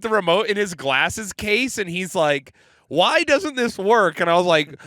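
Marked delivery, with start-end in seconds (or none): 2.9-4.2 higher, slow, drawn-out puzzlement; retold bewilderment. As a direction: imitate a baffled grandpa's question. Grandpa baffled by the remote.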